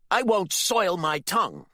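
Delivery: high, loud, outraged shout; shouted, strained disgust; furious outburst. A narrator's reading. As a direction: explode in righteous fury. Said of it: "He wasn't quiet any longer".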